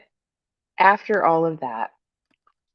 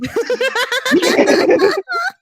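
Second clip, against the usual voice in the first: a high rising laugh. Real, not acted. Mother laughing at the ordeal with her friend, high laugh.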